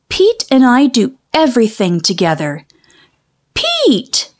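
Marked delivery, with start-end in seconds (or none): 3.5-4.3 calling out. Acted. Calling out to pet Pete.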